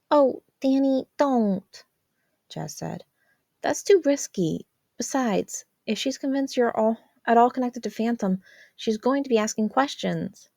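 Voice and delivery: high, urgent sister voice; a sister insisting he see the danger; Jazz, exasperated. A narrator's reading. Jazz warning Danny about Mom, high and urgent.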